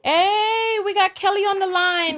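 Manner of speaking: high-pitched, delighted hello; excited greeting. Real, not acted. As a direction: welcome a caller with excitement. Host greets caller from abroad.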